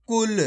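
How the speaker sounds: "कुल" with a brief u and released l; short vowel. Shown as a demonstration. Teacher repeating the joined word, short u in "कुल". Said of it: "छोटू की मात्रा को कम खींच के पढ़ा जाता है".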